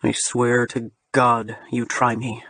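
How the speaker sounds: low slow threatening voice; low menacing threat. Acted. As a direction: threaten him slowly, low. Julian growling threat.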